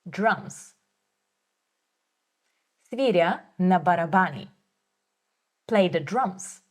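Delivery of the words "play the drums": normal talking voice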